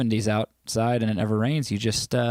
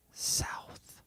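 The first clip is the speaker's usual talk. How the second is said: whisper; 14 dB quieter than usual. Pretend. Whispering the word south.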